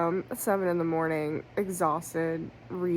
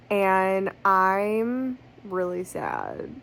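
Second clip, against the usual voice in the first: ordinary talk, nothing marked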